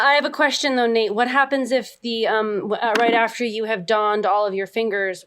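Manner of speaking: usual speaking voice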